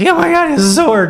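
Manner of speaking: put-on high, loud frightened child's cry; scared little kid. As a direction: gasp it out like a small kid seeing a real sword. Little kid scared of stage sword.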